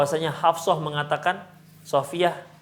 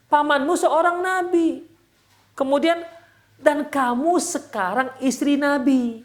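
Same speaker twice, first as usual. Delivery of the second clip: high, slow, emphatic reassurance; retold uplifting; 9 semitones higher, slower than usual. Husband telling wife her worth, uplifting. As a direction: build up their worth phrase by phrase. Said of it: "membesarkan hati Sofia".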